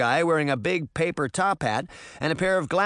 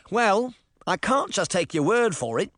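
put-on Tim, English boy; 6 semitones higher than usual. Tim's English voice.

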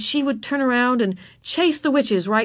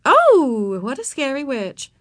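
absent-minded mock fright; 5 semitones higher, 4 dB louder, slower than usual. A narrator's reading. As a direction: humour a child, only mildly scared. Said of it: "said Miss Binny rather absent-mindedly".

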